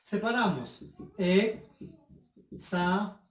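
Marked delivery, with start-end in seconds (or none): 1.2-3.2 "Exámenes" as e-xá-me-nes, long gaps; very slow, one syllable at a time; split into syllables. Shown as a demonstration. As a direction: separate each syllable of "Exámenes". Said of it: "separar las palabras en sílabas".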